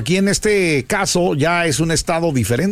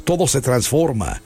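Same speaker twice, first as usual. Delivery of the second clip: solemn reflection. Acted.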